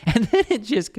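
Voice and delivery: can't hold back the giggle; real laughter. Real, not acted. Laughing at traders who bought the top. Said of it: "I'm sorry, I'm laughing".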